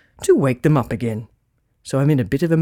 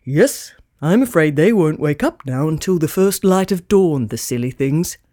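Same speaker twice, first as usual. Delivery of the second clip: put-on lower, glum old Santa voice; a touch of despair in Santa; 3 semitones lower than usual. Santa stumped without his reindeer, glum low voice. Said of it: "said Santa glumly".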